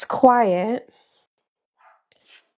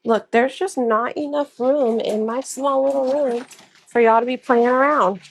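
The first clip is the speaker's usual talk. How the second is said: ordinary talk, nothing marked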